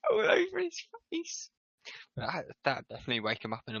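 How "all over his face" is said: high, squeaky laughing through the words; helpless laughter. Real, not acted. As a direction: try to read while cracking up. Reader cracks up while reading.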